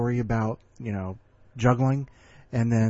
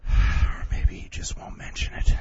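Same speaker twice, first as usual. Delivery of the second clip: whisper. Real, not acted. Host aside after imagined complaint.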